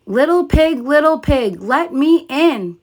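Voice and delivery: wolf demanding to be let in; wolf bellowing. A narrator's reading. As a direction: call in big and demanding. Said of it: "bellowed the wolf".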